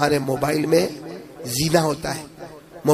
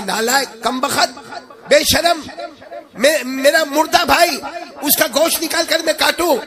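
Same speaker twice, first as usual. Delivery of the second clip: outraged scolding; 10 semitones higher than usual. Retold or imitated. Brother scolding the friend, furious outrage. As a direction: shout the insults, appalled.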